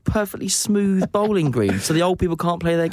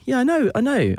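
mum voice; 9 semitones higher than usual. Retold or imitated. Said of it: "my mum was like".

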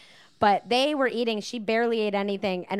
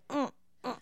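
put-on pouty baby murmur; 4 semitones higher, 7 dB quieter than usual. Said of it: "she's just very like".

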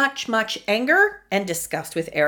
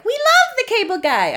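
high, loud, adoring cry; gushing movie wife; 5 dB louder than usual. Acted. Host voicing the oblivious wife in Cable Guy, high-pitched gushing. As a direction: gush it way up high. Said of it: "wife was like".

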